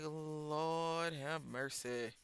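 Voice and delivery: exasperated. Real, not acted. Exasperated aside.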